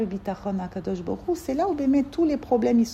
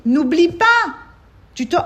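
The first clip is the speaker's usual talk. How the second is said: loud, raised-pitch reminder; urgent called-out warning; 8 semitones higher, 8 dB louder than usual. Acted. Hachem to the soul thrown from the plane, loud urgent warning. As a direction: call it out as a last warning.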